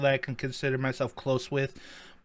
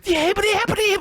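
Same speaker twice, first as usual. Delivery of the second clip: high excited voice; 6 dB louder than usual. Real, not acted. Fumbling for a name.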